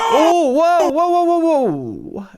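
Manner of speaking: high-pitched run of whoas, falling away; startled, high and drawn-together exclamation; alarmed recoil. Real, not acted. Recoiling from something on screen.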